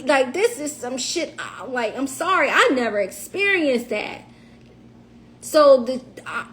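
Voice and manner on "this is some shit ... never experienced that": normal talking voice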